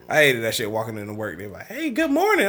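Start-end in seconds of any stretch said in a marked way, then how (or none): 1.7-2.5 high-pitched, mock-cheerful coworker; fake happy greeting. Coworker greeting him walking into work, mock happy. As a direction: imitate a chirpy morning hello. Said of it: "Be sounding all happy and shit".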